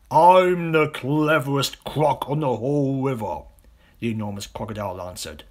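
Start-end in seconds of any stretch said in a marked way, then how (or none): 0.1-3.4 loud proud boast; boastful croc. Acted. Enormous crocodile boasts back at the small one, loud. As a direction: puff up and boast.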